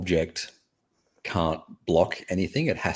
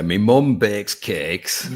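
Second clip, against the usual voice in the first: put-on slow, stretched Yorkshire accent, a bit louder; 3 semitones higher, 6 dB louder, much slower than usual. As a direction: drag it out in a playful Yorkshire accent. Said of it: "you do it with a bit of a Pommy accent".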